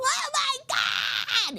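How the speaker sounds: high, slow, drawn-out cry of shock; imitating a guy who spat out his shot, shouting in disgust. Retelling the potluck guest spitting out the shot, high shocked cry. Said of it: "and he was going".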